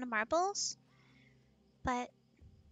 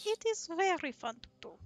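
Russian with tongue pushed into it; bad Russian accent; 3 semitones higher than usual. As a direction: lean hard into mock Russian. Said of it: "put your tongue into it".

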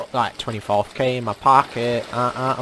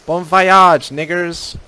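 loud farewell; 4 semitones higher, 7 dB louder, slower than usual. Acted. Sending someone off.

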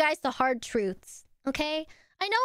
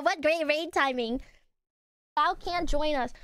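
usual speaking voice

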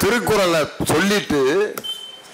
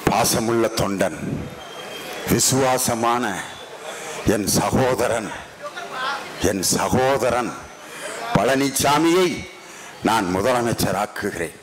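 lower, slow mock speech of the leader; imitating the lady leader; 8 semitones lower, 4 dB quieter, slower than usual. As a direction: ham up her solemn promise, pitched low. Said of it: "உடனே அந்த அம்மா".

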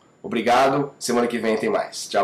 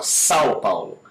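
American "São Paulo", said "Sow Paolo"; American-accented "São Paulo" with "sao" for "São"; 4 semitones lower than usual. Shown as a demonstration. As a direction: drop the nasal from "São" like an American. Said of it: "ele fala".

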